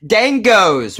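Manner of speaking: a high, loud, badly faked Australian accent; mock Australian accent. Streamer attempts an Australian accent for chat, badly faked. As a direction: bluff an Aussie accent and laugh it off. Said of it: "That's not Australian at all".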